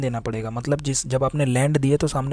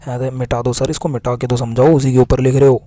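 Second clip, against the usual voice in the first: mock student protest growing louder to a near shout; building indignant pupil yelling at sir; student shouts at sir to erase the board; student complaining; 9 dB louder than usual. Teacher voices student about whiteboard.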